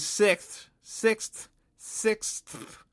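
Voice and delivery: "sixth" with a struggling, drawn-out final th; carefully forcing out the end of "sixth"; laboured pronunciation. Real, not acted. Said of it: "really hard to say sixth".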